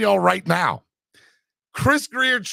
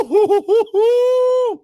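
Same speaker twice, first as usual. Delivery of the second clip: high, loud whooping hoots; excited hoots; 8 dB louder, much slower than usual. Real, not acted. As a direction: hoot with joy like a fan celebrating. Celebrating the trade news.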